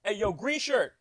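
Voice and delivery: loud, high hollering at passers-by; big mock yell for attention; imitated yelling. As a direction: call out to strangers like a rowdy vendor. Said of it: "yelling at people, pointing people out".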